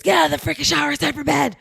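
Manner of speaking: loud, high barked order; aggressive yell. Retold or imitated. Husband ordering girls out of the shower as they hear it, aggressive. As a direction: imitate a parent yelling orders at kids. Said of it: "But what we hear is".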